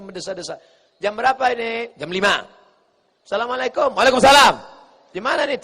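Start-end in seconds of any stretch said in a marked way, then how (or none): 3.2-5.6 high, loud, no-nonsense exchange; brisk phone manner. Shown as a demonstration. Preacher shows how to answer a wrong-number call, brisk loud voice. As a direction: answer briskly and forcefully, no lilt. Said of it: "Suara aja yang normal".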